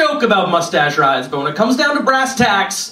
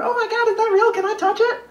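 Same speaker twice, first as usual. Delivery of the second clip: gushing fan. Retold or imitated.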